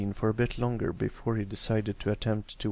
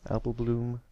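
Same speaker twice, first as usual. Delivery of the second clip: usual speaking voice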